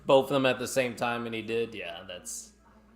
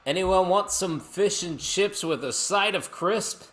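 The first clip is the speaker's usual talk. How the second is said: high, loud, drawn-out offer; slow, raised hawker-like call; mock calling out; 10 semitones higher, 6 dB louder, slower than usual. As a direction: sing out a jokey food order. Jokily calling out a food offer.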